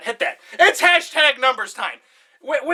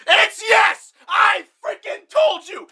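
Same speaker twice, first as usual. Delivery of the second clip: triumphant shout; 9 semitones higher, slower than usual. Real, not acted. Presenter after the wheel lands on yes, triumphant shout. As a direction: crow like you just won the bet.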